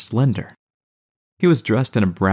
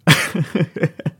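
laugh; 9 semitones higher than usual. A narrator's reading. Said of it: "Max threw his head back and laughed".

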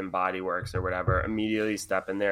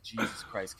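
much softer muttered curse; under her breath; 11 dB quieter than usual. Retold or imitated. Hiding in the stall, cursing softly to herself. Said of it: "and I'm like".

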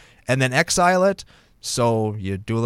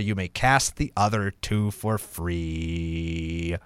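dramatic reveal; 7 semitones lower, 5 dB quieter, much slower than usual. Acted. Host reading the last ultimatum, low slow hammed-up reveal. Dramatic card text finale.

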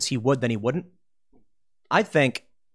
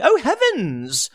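slow, higher, scandalized gasp of a snob; mock prissy elite; 8 semitones higher, much slower than usual. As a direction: drawl it out like a clutching-pearls aristocrat. Shocked political elites.

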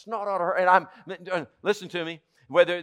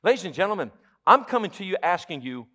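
usual speaking voice

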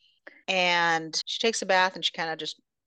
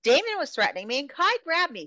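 heated accusation; 7 semitones higher, 4 dB louder than usual. Retold or imitated. Rika accusing the group.

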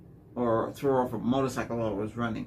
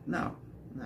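normal talking voice

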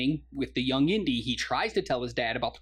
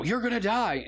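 higher-pitched, urgent retold warning; mock warning; 6 semitones higher than usual. Retelling Indy warning his enemy on the boat, mock warning. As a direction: imitate a hero's alarmed warning.

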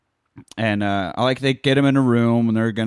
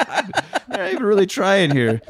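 higher, mock-exasperated aside; mock exasperation; 10 semitones higher than usual. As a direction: roll your eyes through it. Mocking bad acting.

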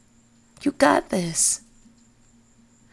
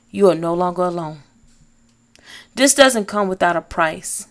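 ordinary talk, nothing marked

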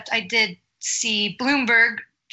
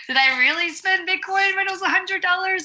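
incredulous; 7 semitones higher than usual. Retold or imitated. Self-mocking disbelief at past spending.

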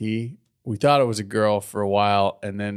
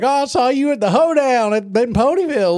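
much higher, louder bumpkin squeal; excited hillbilly; 6 dB louder than usual. Acted. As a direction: gush like a thrilled country boy. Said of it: "he's all excited".

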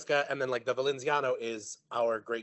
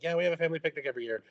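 rattled-off quote; 2 semitones higher, much faster than usual. Retold or imitated. Quoting what she tells people.

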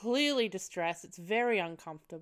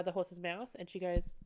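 ordinary talk

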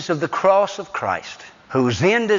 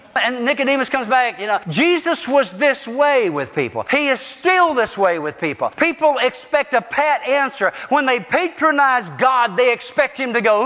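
rising, ringing pulpit cadence, each line pushed higher; preaching at full force; 10 semitones higher than usual. Acted. Preaching high.

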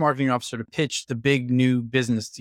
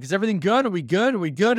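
retold, pitched-up nervous checking; anxious asking; 6 semitones higher, faster than usual.

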